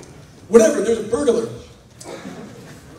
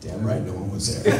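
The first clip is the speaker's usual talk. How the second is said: smug mutter; 12 semitones lower, 5 dB quieter than usual. Retold or imitated. Smug muttering after finding nobody.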